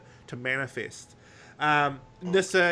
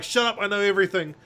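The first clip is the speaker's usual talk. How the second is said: higher, louder, fast dismissive snap; hammed-up know-it-all villain; mock Master brushing someone off; arrogant Master; 7 semitones higher, 6 dB louder, faster than usual. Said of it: "And the Master's like".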